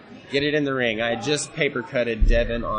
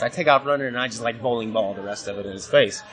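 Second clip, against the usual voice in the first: normal talking voice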